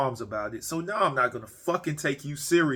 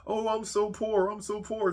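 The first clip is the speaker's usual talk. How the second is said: mock poor whine; 7 semitones higher, 3 dB quieter than usual. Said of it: "You know?".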